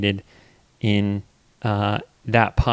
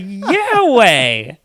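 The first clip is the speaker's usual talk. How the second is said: put-on talking to toddler; 10 semitones higher, 7 dB louder than usual. Dad replying to toddler.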